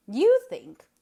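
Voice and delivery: loud, high, scornful bark on you; utter contempt. Acted. Eliza scornfully dismissing her sister's opinion, loud. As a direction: sneer it out with scorn. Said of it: "voice expressed the utmost contempt".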